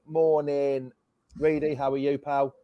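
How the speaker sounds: usual speaking voice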